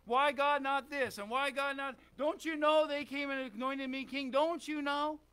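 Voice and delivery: mock disgruntled outburst; mad at God. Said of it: "He could have got mad at God".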